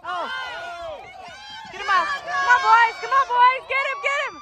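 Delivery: high loud repeated shouts; yelling from the sidelines. Real, not acted. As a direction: cheer the defense on hard. Urging defense from sideline.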